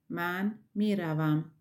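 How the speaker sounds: normal talking voice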